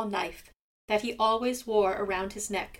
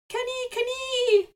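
very high, far-off gull call; seagull cry; 4 dB louder than usual. A narrator's reading. Seagull mourning his lost daylight, high gull cry. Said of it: "you can hear him crying".

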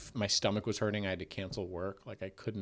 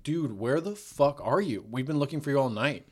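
frantic friend; 5 semitones higher, 5 dB louder than usual. Retold or imitated. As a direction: yell it like a panicked friend. Friend yelling on phone.

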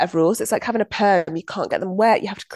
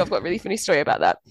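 ordinary talk, nothing marked

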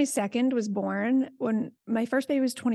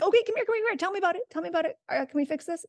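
high, gentle coaxing invitation; tender higher welcoming voice to the child; welcoming parent; 8 semitones higher, much faster than usual. Retold or imitated. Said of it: "It's like".

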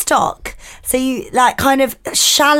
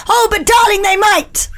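high, fretting coo to a darling; fussing helicopter mum; 8 semitones higher than usual. Pretend. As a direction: coo anxiously like an overprotective mum. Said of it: "landing in the helicopter parent".

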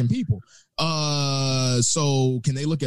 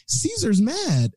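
imitating the lady; 9 semitones higher, much slower than usual. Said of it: "she was all like".

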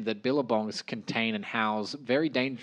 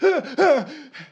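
high squealing laugh; 6 dB louder than usual. Real, not acted.

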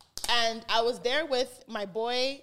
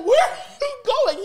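calling after him; 13 semitones higher, 11 dB louder than usual. Retold or imitated. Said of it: "And I'm like".

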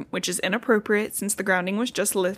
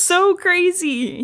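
amazed; 9 semitones higher, much slower than usual. Real, not acted. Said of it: "laughing comment".

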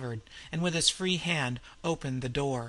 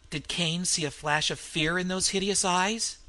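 usual speaking voice

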